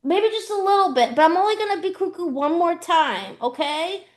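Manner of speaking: put-on high little-kid voice, defensive and bargaining; imitating a small child. Three-year-old defending himself to his brother, high, kid voice. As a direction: pipe up like a toddler insisting he's fine.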